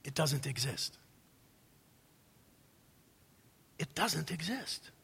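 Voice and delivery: very soft, low statement; hushed emphasis. Real, not acted. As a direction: drop almost to a murmur to make the point. Preacher hushes the answer for emphasis.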